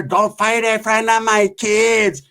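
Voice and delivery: high, slow, drawn-out plea; imitated pleading parent. Bystander pleads as they brawl, high mock wail.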